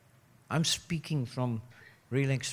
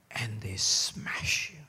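hushed whisper; 4 semitones lower, 5 dB quieter, slower than usual. Real, not acted. Sermon climax of betrayal.

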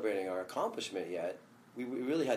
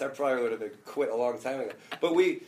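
ordinary delivery

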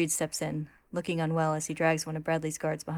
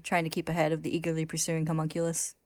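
usual speaking voice

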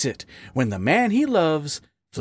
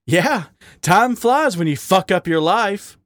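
higher-pitched, firm retort; 7 semitones higher than usual. A narrator's reading. Raccoon answering his partner about the hour, firm. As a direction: say it firmly, with a bitter edge. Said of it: "Wally said firmly".

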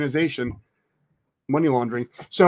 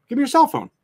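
stern parent; 11 semitones higher, faster than usual. Pretend. Parent demanding phone from teen.

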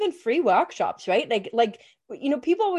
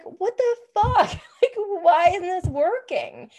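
exasperated quote; 8 semitones higher than usual. Retold or imitated. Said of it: "I was like".

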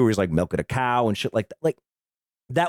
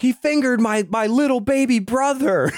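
high mock whine; 11 semitones higher than usual. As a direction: squeal it like a tattling son, pitched way up. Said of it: "He's like".

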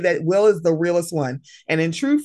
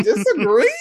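high, louder incredulous question; shocked squeak at the poll result; mock disbelief; 12 semitones higher, 5 dB louder than usual. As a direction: act stunned that anyone disagrees. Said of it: "I'm like".

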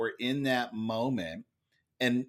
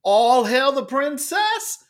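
slow, much higher, louder mock herald's call; royal proclamation; 12 semitones higher, 7 dB louder, slower than usual. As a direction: announce it like a court herald hailing royalty. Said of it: "He then went".

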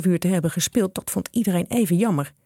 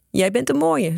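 a touch of amusement, higher pitch; chuckling; 7 semitones higher than usual. A narrator's reading. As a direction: say it as if amused by the lad. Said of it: "zei hij grinnikend". (licht grinnikend)

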